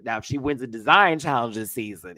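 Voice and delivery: ordinary talk, nothing marked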